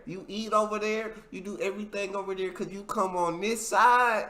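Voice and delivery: acted street threat building in pitch; threatening fan. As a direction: warn them off your block. Acts out threatening fans.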